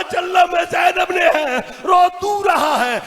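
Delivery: high, crying lament at full pitch; impassioned imitation of a weeping aunt; tearful wail. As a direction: wail it out, stretching the last words. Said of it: "रो के कहती".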